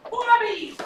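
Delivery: "Wobby" squeaked very high with a w; high squeaky "Wobby", R as w; says w for r. Shown as a demonstration. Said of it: "I just can't say my R's".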